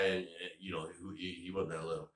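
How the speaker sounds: ordinary talk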